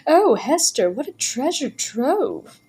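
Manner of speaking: high, slow rapturous gush; breathless treasure-hunter delight. A narrator's reading. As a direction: gush over the dolls. Woman enraptured by dolls.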